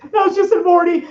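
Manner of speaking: high, nervous Morty voice; stammering teen whine. Retold or imitated. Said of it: "impression of Morty".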